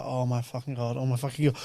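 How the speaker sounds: soft, low exasperated groan; despairing mutter. Retold or imitated. Driver despairing at rowdy passengers, soft and low.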